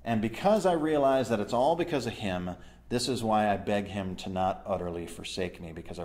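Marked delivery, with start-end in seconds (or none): none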